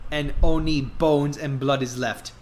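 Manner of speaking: ordinary talk, nothing marked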